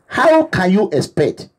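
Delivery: ordinary delivery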